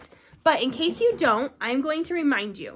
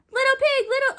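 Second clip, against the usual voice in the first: high, louder wolf call; big bad wolf; 13 semitones higher, 4 dB louder, faster than usual. Wolf calling the second pig, high and louder. Wolf voiced in retold tale.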